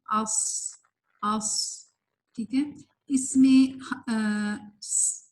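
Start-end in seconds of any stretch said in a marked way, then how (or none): 0.1-1.8 thick, back-raised s held at the end of اس; heavy letter. Shown as a demonstration. Teacher showing how you will read it, heavy whistled ص. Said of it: "یہ موٹا حرف ہے". 4.8-5.2 long steady hiss on س; whistling sound. Shown as a demonstration. Teacher showing the same whistle sound, long hissed س. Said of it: "وہی، وہی sound ہے".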